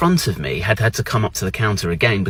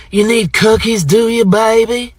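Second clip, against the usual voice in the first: high, drawn-out Southern-server impression; pitched-up, slow and flirty mimicry; American counter lady; 9 semitones higher, 3 dB louder, slower than usual. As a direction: imitate a server sweet-talking a customer. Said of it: "she came over with my cookies and she went".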